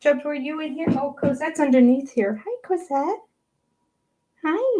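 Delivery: high-pitched cat-greeting voice; talking to a pet. Real, not acted. Vlogger spotting her cat underneath, high pet voice. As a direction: coo a hello to the cat up high.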